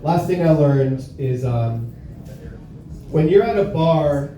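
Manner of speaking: usual speaking voice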